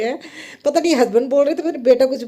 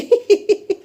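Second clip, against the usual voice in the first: high bright laugh; 9 semitones higher, 3 dB louder than usual. Real, not acted. Amused at son getting ready.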